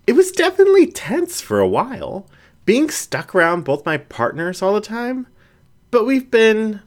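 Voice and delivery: put-on a hesitant young raccoon confessing, voice pitched high; nervous raccoon girl trailing off; raccoon Jen, tense. A narrator's reading.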